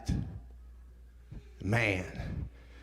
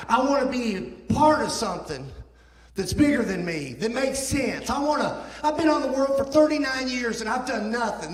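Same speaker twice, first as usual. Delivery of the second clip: impassioned cry; 10 semitones higher, 4 dB louder than usual. Retold or imitated. Crying out his despair.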